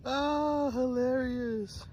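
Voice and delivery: high mock-delighted drawn-out "hilarious"; sarcastic. Real, not acted. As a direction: fake amusement, stretch it out slowly. Sarcastic reaction to clip title.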